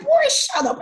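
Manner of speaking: high, loud retold scolding; dismissive mock shout. Mother brushing off her son's fears, dismissive shout. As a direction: cut him off in an acted high-pitched outburst. Acted brush-off to worried son.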